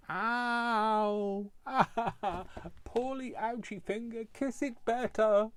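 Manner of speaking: put-on high baby-talk voice; kissing it better. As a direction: coo it like a toddler with a scraped finger. Cut finger, babyish self-comfort.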